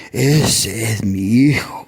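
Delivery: lightly voiced God, low and slow; voice of the Lord. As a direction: speak slowly as God naming a son. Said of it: "el Señor me dice".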